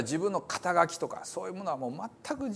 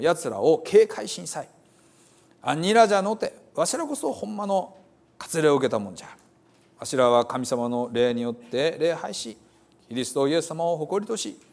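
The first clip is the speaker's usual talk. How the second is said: Hiroshima dialect reading; 3 dB louder than usual. Acted. Preacher reading Paul's letter to the brothers in Hiroshima dialect. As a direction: read the scripture as a Hiroshima local would say it. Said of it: "私の創作じゃないですからね".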